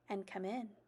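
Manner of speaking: mother softly calling her home; tender mother. A narrator's reading. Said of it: "her mother said".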